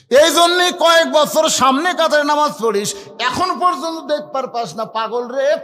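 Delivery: high, loud berating; acted scolding. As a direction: tell someone off at preaching pitch. Shepherd scolds man beside him.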